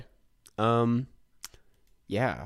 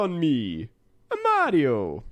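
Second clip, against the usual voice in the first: Mario voice; 8 semitones higher, 4 dB louder than usual. Acted. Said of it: "that sounded like a Jamaican accent".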